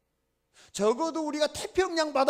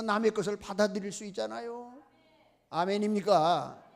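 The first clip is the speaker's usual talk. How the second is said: ordinary delivery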